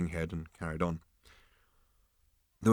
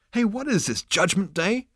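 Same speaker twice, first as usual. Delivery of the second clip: high-pitched irritable snap; snapping; 12 semitones higher than usual. A narrator's reading. Zaphod reacts to a voice under the table, snappish. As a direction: snap the question in exasperation. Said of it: "snapped Zaphod".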